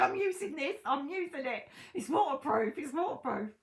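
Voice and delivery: high, loud comic self-impression, laughing; hammed-up high-pitched insistence through laughter; mock stubborn insistence. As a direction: play yourself defiantly sitting out in bad weather, laughing. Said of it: "Sit there like that, going".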